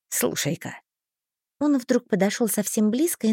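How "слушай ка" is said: low confidential. A narrator's reading. Thin leans close.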